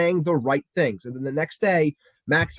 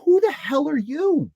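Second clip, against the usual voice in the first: 1 mock outrage; 9 semitones higher than usual. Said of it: "I was like".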